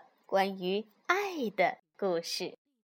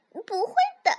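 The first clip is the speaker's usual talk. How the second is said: high, louder little fox reply; giggling reply; 10 semitones higher, 6 dB louder, faster than usual. A narrator's reading. Little fox reassures worried mother, lightly giggling voice. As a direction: toss it off in a high cub voice. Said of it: "小狐狸咯咯笑着说".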